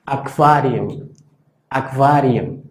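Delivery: aquarium: qu suena kv, akvarium; pronunciación alemana. Shown as a demonstration. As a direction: di aquarium como akvariom, sin u. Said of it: "No suena la U". (aquarium con kv, lento)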